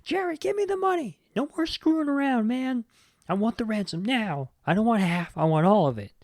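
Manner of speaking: high, put-on voice demanding the ransom; mock Carl, fed up and pushy; lightly voiced angry demand; mad Carl. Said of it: "He's just mad".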